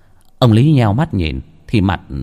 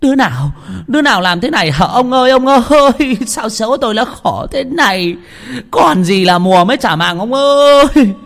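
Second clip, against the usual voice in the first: high, loud keening wail; a wife wailing over the lost harvest; moaning lament; 12 semitones higher, 4 dB louder than usual. Acted. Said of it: "miệng rên rỉ". (than khóc rên rỉ, giọng cao)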